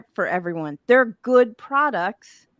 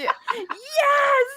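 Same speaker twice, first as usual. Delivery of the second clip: high squeal of laughing delight; laughing shout. Real, not acted. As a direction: shriek with laughter. Delighted by green screen joke.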